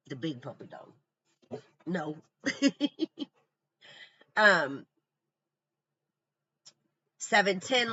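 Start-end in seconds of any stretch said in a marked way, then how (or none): 0.1-2.2 pet talk. Real, not acted. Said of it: "Sorry, the puppy dog".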